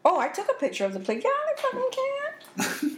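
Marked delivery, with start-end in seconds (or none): 1.2-3.0 much higher, incredulous; emphatic high retort. Real, not acted. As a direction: snap back with disbelief.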